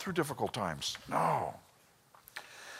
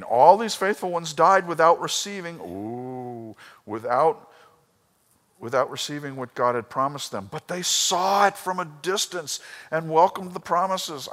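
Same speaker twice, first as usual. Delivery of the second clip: full-force preaching; 7 semitones higher, 7 dB louder than usual. Acted. Pastor proclaims Abraham's faith to church, booming. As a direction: preach it out to the congregation.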